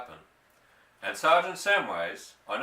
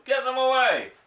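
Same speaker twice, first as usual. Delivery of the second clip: a high, loud panicked scream; 12 semitones higher, 8 dB louder than usual. A narrator's reading. Mister Hazel begging them to clear his car, screaming. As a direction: shriek it, desperate. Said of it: "screamed Mister Hazel".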